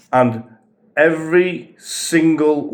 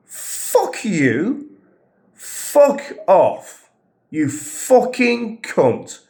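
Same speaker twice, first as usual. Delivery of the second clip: angry swearing; 7 semitones higher, much slower than usual. Real, not acted. Furious at ignoring manager.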